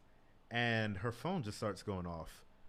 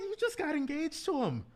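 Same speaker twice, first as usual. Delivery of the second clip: shocked and exasperated, pitched way up; incredulous outburst; 14 semitones higher than usual. Retold or imitated. Retold outrage at kissing friend.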